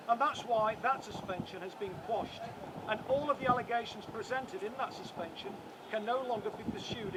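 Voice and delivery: measured public statement read aloud; formal legal reading. Acted. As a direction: deliver it like a prepared press statement. Reads prepared statement to press.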